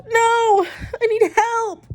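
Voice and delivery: hammed-up tearful cry for help; half-laughing mock sob; mock crying plea. Retold panic, cry heard.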